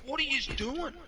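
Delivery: hammed-up dad yelling at Pop; high laughing mock-angry dad; outraged dad. Dad outraged at shooting near houses.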